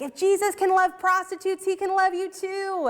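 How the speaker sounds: high, ringing mock revival proclamation; revival preacher. Preacher to a big revival crowd, imitated high-pitched proclaiming. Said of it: "big revivals and things".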